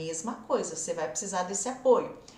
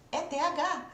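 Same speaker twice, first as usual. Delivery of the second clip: ordinary delivery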